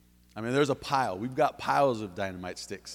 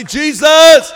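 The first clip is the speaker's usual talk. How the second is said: far higher, far louder desperate cry; blind man's yell; 23 dB louder than usual. Retold or imitated. Blind Bartimaeus calling out to Jesus, full-throated yell. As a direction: shout the name across the road. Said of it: "yell his name out".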